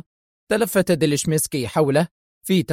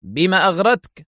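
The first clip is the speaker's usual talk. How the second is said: sharp, higher-pitched demand; stern, sharp; 5 semitones higher, 3 dB louder than usual. A narrator's reading. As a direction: question him sternly, voice raised. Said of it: "يسأله في حدة صارمة".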